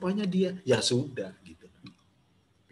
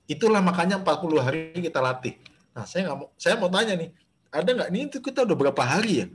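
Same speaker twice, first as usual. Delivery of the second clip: ordinary delivery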